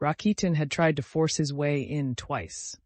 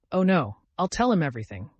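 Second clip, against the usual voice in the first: ordinary delivery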